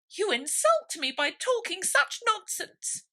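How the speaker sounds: very high, loud, slow; insulted mouse. A narrator's reading. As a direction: lecture her, deeply offended. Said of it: "walking away".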